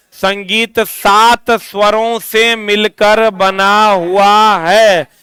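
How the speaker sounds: deliberate, word-by-word, drawn-out delivery; slow dictation. Shown as a demonstration. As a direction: spell out the definition slowly and firmly. Stating a definition for notes. (एक-एक शब्द खींचकर, धीरे बोलते हुए)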